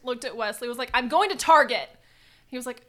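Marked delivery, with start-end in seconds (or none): 1.0-1.9 fed-up announcement. Retold or imitated. Announcing in a vacuum rage.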